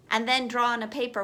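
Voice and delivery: ordinary delivery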